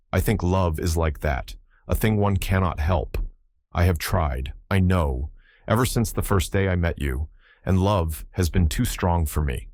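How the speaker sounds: plain reading voice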